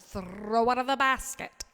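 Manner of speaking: "Throw out of the basket" hit loud, R trilled; emphatic repeat. Real, not acted. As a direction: THROW with a long rolled R.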